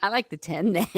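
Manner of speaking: imitating weirdo kid. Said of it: "He's all".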